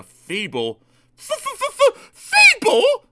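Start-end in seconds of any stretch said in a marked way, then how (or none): 1.1-3.1 stuttered f-f-f, then a high, loud burst; spluttering outrage. A narrator's reading. Daisy echoing the shunter's insult, spluttering. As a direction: splutter the word back, affronted. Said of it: "spluttered Daisy".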